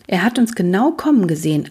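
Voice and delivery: plain reading voice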